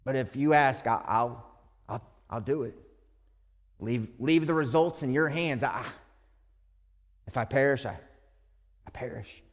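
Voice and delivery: low, soft, faltering prayer; quiet unsure resignation; hesitant surrender. Retold or imitated. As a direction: trail off to a whisper on perish. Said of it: "I can be the person that says".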